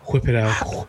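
"whip" with a low, blown HW; airy stretched wh on "whip"; mock ASMR wh. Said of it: "this is A S M R".

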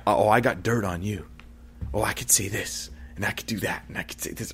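a man tempted to hit back muses, dropping to a whisper; fading inner temptation. Acted.

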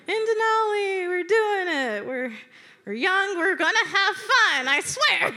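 high, loud pep-talk chant; hammed-up determined cheerfulness; forced cheer. Campers rallying themselves for fun, forced cheer.

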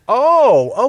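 loud, high drawn-out "oh"; dawning realisation. Retold or imitated. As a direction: replay the moment it clicks, exaggerated. Retells sudden realisation.

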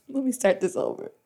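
ordinary talk, nothing marked

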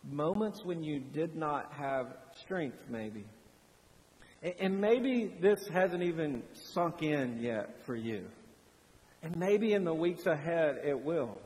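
normal talking voice

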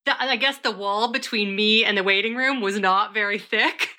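normal talking voice

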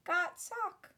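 high elf voice in disbelief; tiny, stunned house-elf; Dobby. Acted. House-elf cannot believe he got a sock, in disbelief. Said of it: "said Dobby in disbelief".

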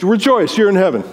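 ordinary delivery

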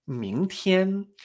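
"Mingtian" rising, then held high; tones two-one. Shown as a demonstration. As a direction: climb on "Ming", sustain "tian" high and level. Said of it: "El tono dos uno".

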